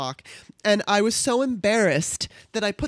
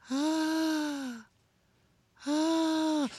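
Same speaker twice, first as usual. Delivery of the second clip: moaning; 9 semitones higher, 6 dB quieter than usual. Retold or imitated. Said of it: "She was going".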